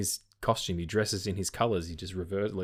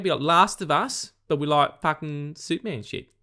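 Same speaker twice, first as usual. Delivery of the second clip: exaggerated Aussie bloke, high and loud; broad Australian accent; 10 semitones higher, 4 dB louder than usual. Mock broad Australian while pitching a video game. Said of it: "so my Australian accent comes out".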